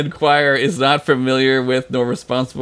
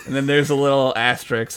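normal talking voice

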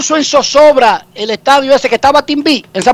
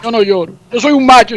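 ordinary delivery

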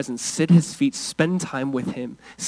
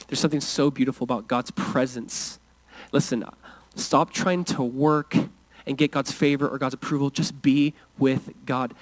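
usual speaking voice

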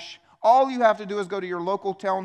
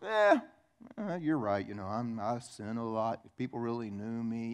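defeated sigh; 6 semitones lower, 6 dB quieter than usual. Retold or imitated. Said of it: "we're just like".

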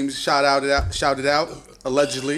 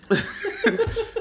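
high-pitched laugh; squeaky burst of laughter; high giggle. Real, not acted. Laughs at own joke.

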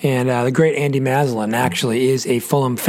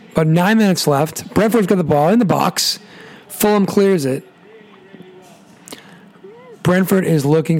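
live play-by-play; 2 semitones higher than usual. Acted. Live match call.

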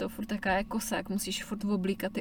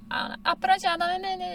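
put-on high child voice; 10 semitones higher than usual. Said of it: "já říkám".

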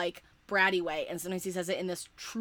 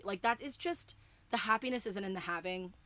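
ordinary talk, nothing marked

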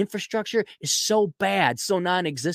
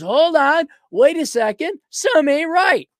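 alarmed parent; 8 semitones higher, 4 dB louder, slower than usual. Retold or imitated. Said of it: "some parent says".

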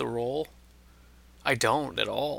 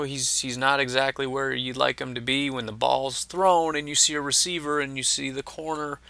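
ordinary delivery

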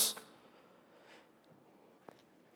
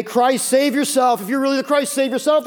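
mocking criminal; 6 semitones higher, 4 dB louder than usual. Criminal beside Jesus mocks him, mild higher sneer. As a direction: taunt him lightly from the next cross. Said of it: "making fun of him".

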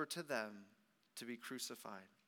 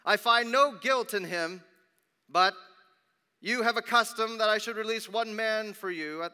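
loud, higher-pitched public address; addressing a crowd; 5 semitones higher, 11 dB louder than usual. A narrator's reading. Pilate to the crowd outside, loud public appeal. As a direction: project it out to the people.

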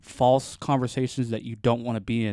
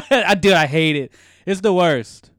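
louder, higher, really hitting it; emphatic complaint; 4 semitones higher, 7 dB louder than usual. Real, not acted. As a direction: declare it the worst with feeling. Venting about hated fake laughs.

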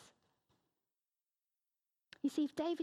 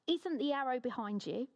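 ordinary delivery

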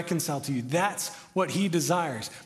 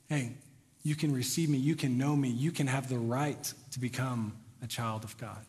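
softer and lower, gentle welcome; tender invitation; 5 semitones lower, 6 dB quieter than usual. Pretend. God inviting the one who rejected him, soft and tender. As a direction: invite them in quietly, tenderly.

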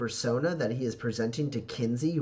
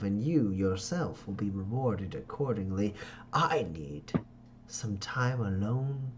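sheepish schemer. Acted. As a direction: drawl out the excuse like a silky politician. Ferdinand dismisses Pax.

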